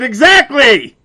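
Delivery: high and loud, a gotcha Exactly!; catching the clerk out in his own words, loud and high; triumphant exclamation. Retold or imitated. Pounce on it, loud and high-pitched.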